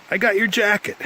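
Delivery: high-pitched retold call, little added volume; imitated calling out. Guy returning a lost jacket, imitated high call-out.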